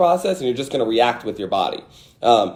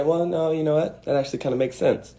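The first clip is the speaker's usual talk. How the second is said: thoughtful concession; 2 semitones higher, 7 dB quieter than usual. Retold or imitated. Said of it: "I say".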